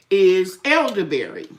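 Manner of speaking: normal talking voice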